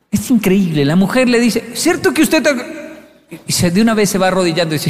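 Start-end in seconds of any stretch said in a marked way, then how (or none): 1.7-2.6 high-pitched imitation of a questioning wife; wife's accusing question. Wife confronting her husband, accusing question.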